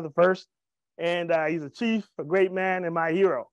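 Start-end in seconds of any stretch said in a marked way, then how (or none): none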